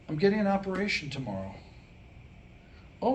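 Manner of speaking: ordinary talk, nothing marked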